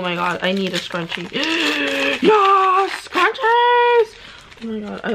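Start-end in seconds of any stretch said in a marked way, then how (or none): 1.3-2.2 excited gasp. Real, not acted. Finds a scrunchie. 2.2-4.2 high, loud cheer of joy; excited shout. Real, not acted. Discovering scrunchies in a fan's gift, joyful high shout. Finds scrunchies she loves.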